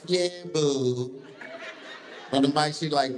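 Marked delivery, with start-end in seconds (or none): 0.1-1.2 high, sugary Yeah, boo; imitating her sweet side; sweet offstage voice. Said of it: "She be like".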